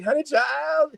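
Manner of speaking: high, sassy motherly soothing. Retold or imitated. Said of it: "she morphed into [name]".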